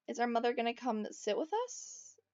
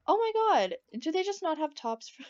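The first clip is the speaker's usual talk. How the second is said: shocked exclamation; 6 semitones higher, 5 dB louder than usual. Real, not acted. Shocked at waitress outfit.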